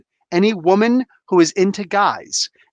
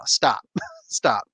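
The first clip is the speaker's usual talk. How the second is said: whisper; 7 semitones lower, 4 dB quieter than usual. Real, not acted. Laughing at himself, whispered.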